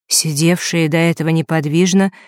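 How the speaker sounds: ordinary delivery, nothing marked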